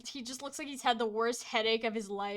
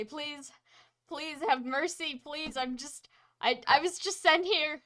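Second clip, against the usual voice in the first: high frantic begging, growing louder; pleading, rising panic; 6 semitones higher, 12 dB louder than usual. Acted. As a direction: beg for your life, faster and faster. Mage begs for mercy.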